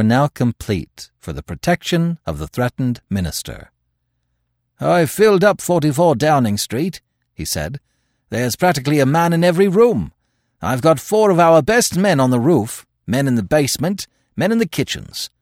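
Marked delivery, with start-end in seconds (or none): 4.8-7.0 high, forceful, brisk official; energetic officer's report, pitch raised; reporting police superintendent. A narrator's reading. 8.3-15.3 high, forceful, brisk official; energetic officer's report, pitch raised; reporting police superintendent. A narrator's reading.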